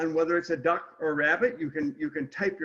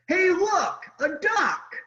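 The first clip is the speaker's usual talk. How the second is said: higher and louder, pointing it out; excited discovery; 13 semitones higher, 3 dB louder, slower than usual. Acted. Reading the duck fan's opening line, excited. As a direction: call out the find like an eager kid. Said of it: "and it starts like this".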